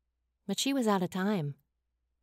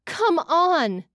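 louder, higher cry of urgency; yelled; 8 semitones higher, 5 dB louder than usual. A narrator's reading. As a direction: yell it out in frustrated urgency. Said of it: "she yelled".

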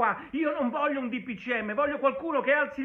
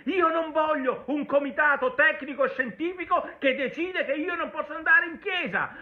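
normal talking voice

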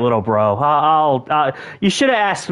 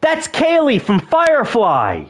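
higher, delighted outburst; excited recognition; 8 semitones higher, slower than usual. Real, not acted. Spotting Kaylee from Firefly, excited. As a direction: burst out high, then stretch the name.

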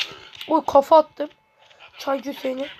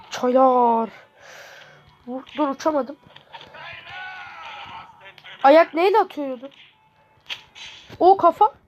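ordinary delivery

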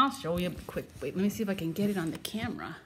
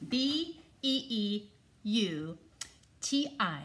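slow spelling-out; 4 dB quieter, slower than usual. Shown as a demonstration. A joking vocabulary lesson for the viewers, spelled slowly. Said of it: "Just a vocabulary lesson for all yous".

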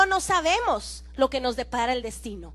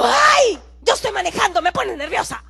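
loud, higher ay of annoyance; exasperated shout; 4 semitones higher, 6 dB louder than usual. Retold or imitated. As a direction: snap back from the driver's seat. (pastora exasperada gritando)

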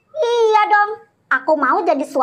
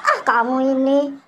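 ordinary delivery

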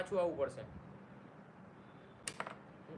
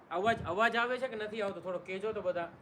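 1 calling out to viewers; 5 semitones higher, 5 dB louder than usual. Real, not acted. Checking audio with live viewers.

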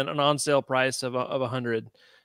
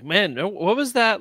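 normal talking voice

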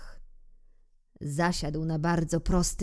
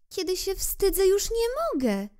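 put-on much higher, shy boy; blushing little prince; 14 semitones higher than usual. Little prince, blushing, answers king, much higher. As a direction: admit you're ashamed. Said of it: "czerwieniąc się".

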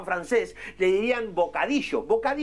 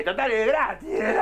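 excited shout. Retold or imitated. Retold excited order at bar. (grito entusiasmado)

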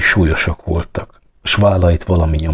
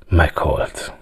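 lower, subdued single word; sombre grief; 3 semitones lower, 4 dB quieter than usual. A narrator's reading. Police chief telling the girl of his loss, sombre. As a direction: let it drop, heavy with loss. Father reveals his daughter died.

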